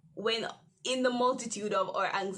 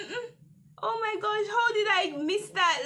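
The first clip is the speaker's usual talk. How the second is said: flustered dismay; 10 semitones higher, 5 dB louder than usual. Retold or imitated. Realising the mistake.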